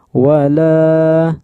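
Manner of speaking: sustained "laa" in "wala"; two-count long vowel. Shown as a demonstration. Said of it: "sama panjangnya".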